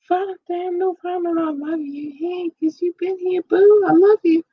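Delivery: tiny, very high squeaky voice; playful squeal. Pretend. Podcast host to loyal Volendam listeners, playful high squeal. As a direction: gush with love in a squeaky little voice.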